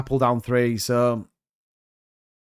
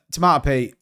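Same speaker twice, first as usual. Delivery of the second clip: ordinary delivery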